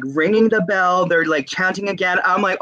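ordinary delivery

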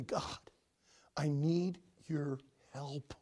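slow, hushed whisper; humble whispered prayer. Acted. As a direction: breathe it as a private plea.

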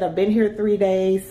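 ordinary talk

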